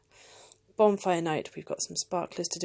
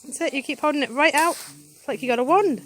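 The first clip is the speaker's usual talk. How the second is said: louder encouraging call across the garden; calling out outdoors; 8 semitones higher, 13 dB louder than usual. Real, not acted. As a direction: call out over the fireworks noise.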